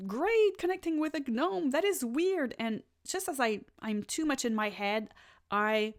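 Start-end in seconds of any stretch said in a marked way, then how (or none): 0.1-2.5 excited. Retold or imitated. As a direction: gush like a thrilled fan meeting a gnome. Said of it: "I'm so excited".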